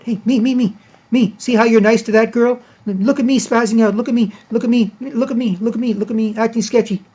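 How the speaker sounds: high, loud mocking whine of an attention-seeker; mock the person craving attention, loud and high; spazzing attention-seeker. Mocking a sketchy partygoer who demands attention, high loud whine. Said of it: "starts spazzing out".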